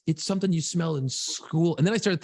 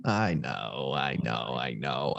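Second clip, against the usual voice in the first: much lower and softer, conceding; sheepish; 6 dB quieter, much slower than usual. Real, not acted. Host admitting to co-host he's the worst, sheepish. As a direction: mumble it, guilty as charged.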